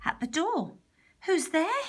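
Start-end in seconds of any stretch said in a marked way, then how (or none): 1.3-1.8 small high-pitched pig asking; high little pig voice. A narrator's reading. Pig answers knock.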